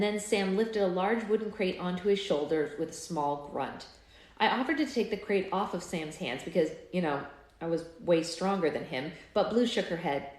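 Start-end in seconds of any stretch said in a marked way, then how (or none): none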